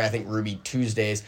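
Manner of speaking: ordinary delivery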